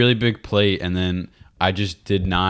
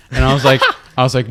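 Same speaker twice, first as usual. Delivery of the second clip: ordinary talk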